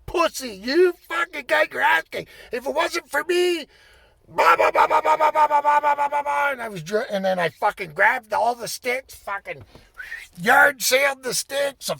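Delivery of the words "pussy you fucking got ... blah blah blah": yelling. Retold or imitated. Player yelling insults at the referee while storming off, angry. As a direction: hurl abuse at him across the rink. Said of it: "like, yelling".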